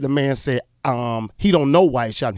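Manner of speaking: normal talking voice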